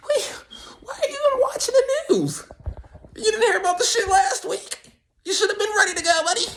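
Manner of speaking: squeaky comic disbelief; high-pitched exasperation. Acted. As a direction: scold mom in a very high, mock-tearful squeak. Acted child berating mom.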